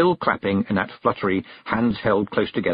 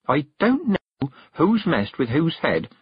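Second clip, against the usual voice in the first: higher, slow and emphatic retort; snapping; 6 semitones higher, slower than usual. A narrator's reading. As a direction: snap back, leaning on each word. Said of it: "snapped Petulia".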